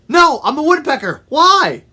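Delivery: high, loud, quick bird voice; loud, high-pitched character retort; shouting woodpecker. Acted. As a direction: shout the answer back down. Woodpecker shouts answer to frog.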